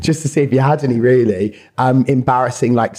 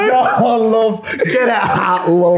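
higher, hammed-up Yorkshire "love" through laughter; mock Yorkshire accent; 8 semitones higher, much slower than usual. Co-host teasing the guest's northern accent, with a hammed-up, laughing Yorkshire voice. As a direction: shout it like a northern cliché, laughing.

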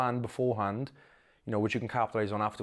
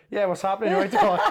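laughing greeting; 7 semitones higher, 6 dB louder than usual. Retold or imitated.

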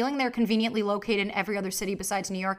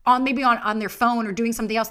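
ordinary talk

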